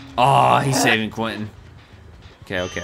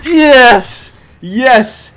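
loud, high cheer; triumphant shout; 13 semitones higher, 12 dB louder than usual. Real, not acted. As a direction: yell it out in victory. Lands the needed hit.

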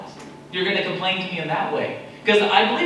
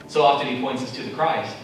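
ordinary talk

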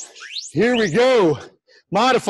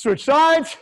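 called out; 7 semitones higher than usual. Real, not acted. Instructor calling out to class.

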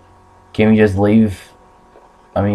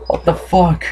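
ordinary talk

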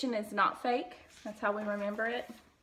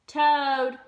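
loud calls, higher pitch; shouting; 5 semitones higher, 9 dB louder than usual. A narrator's reading. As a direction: call out for a friend. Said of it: "shouted Frog".

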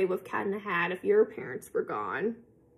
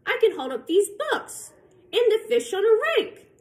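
rising excited boast; 11 semitones higher, 5 dB louder than usual. Acted. Cat balancing, excitement peaks.

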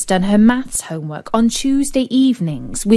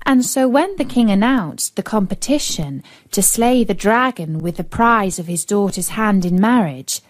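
plain reading voice

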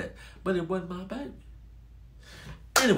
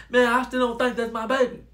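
louder, higher insistence; insistent protest; 7 semitones higher, 8 dB louder than usual. Retold or imitated. Father under pressure from both women, insistent protest. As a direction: push back loudly against everyone.

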